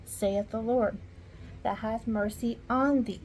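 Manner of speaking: normal talking voice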